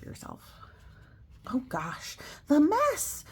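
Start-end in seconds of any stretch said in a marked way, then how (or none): 1.5-3.2 much higher, louder outburst; shocked cry. Real, not acted. Teacher reading the mess page, crying out in shock. Sees messy kitchen page.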